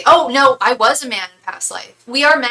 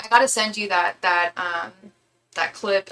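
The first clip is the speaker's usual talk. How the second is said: ordinary talk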